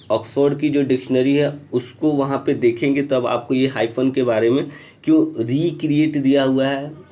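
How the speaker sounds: normal talking voice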